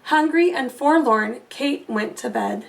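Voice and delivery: ordinary delivery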